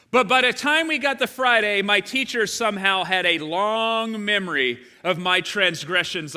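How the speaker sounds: loud, high, fervent preaching; preaching at full force. Acted. Pastor to congregation, fervent loud preaching. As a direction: drive the story home to the congregation.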